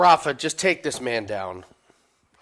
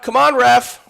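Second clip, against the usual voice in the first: loud high plea to the ref; pleading with the referee; 10 semitones higher, 10 dB louder than usual. Real, not acted. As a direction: beg the ref to step in. Urging ref to stop fight.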